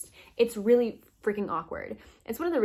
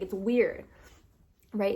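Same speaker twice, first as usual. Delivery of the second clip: usual speaking voice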